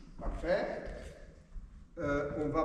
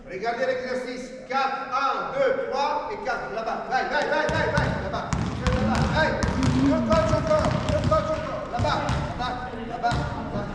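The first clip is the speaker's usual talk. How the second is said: loud, higher counted commands; calling out; 4 semitones higher, 9 dB louder than usual. Real, not acted. Coach calling out the drill count to players, loud. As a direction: call the count across the court.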